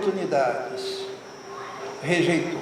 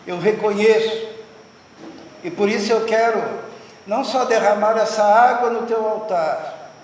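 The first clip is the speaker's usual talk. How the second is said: prayer; 2 semitones higher than usual. Acted. Pastor praying aloud over the altar, fervent and rising. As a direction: pour it out as an offering, rising with each line.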